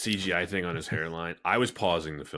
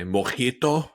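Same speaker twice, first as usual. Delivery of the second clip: "Mojito?" in a put-on Spanish accent, higher and louder; Pierce Brosnan mojito; 6 semitones higher, 4 dB louder, slower than usual. Brosnan asking Halle Berry if she wants a mojito, put-on Spanish accent. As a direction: roll out "Mojito?" like a suave spy offering a drink. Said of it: "the way that Pierce Brosnan does in Die Another Day".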